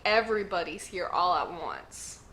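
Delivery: ordinary delivery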